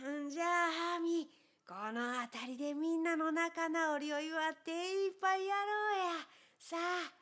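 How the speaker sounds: put-on a hearty old man's voice; jolly old mountain man. Old man inviting everyone to drink, hearty. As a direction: play a cheerful, rough old man.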